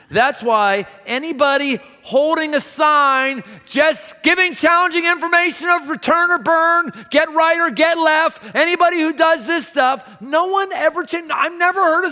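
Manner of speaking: high, loud ranting street preacher; mock street preacher. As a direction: mimic a shouting sidewalk preacher, hammed-up. Mocking a screaming street preacher.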